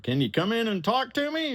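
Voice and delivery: high boss voice on phone. Retold or imitated. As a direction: pitch it up for the caller.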